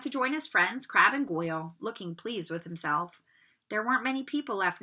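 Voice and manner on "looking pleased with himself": ordinary delivery, nothing marked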